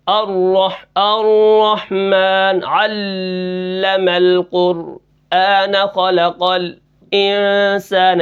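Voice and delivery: slow drawn-out chant of "الرحمن علم القرآن", broken by halts; saktah breaks. Shown as a demonstration. Teacher shows students the fault of saktah, stop-and-restart recitation. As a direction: recite "الرحمن علم القرآن خلق الانسان" very slowly, halting and resuming. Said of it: "یہ سکتہ ہے".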